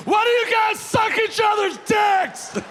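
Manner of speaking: put-on high, loud, drunk shout at strangers; drunken yell. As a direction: bellow it at the whole bar.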